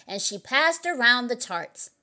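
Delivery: ordinary delivery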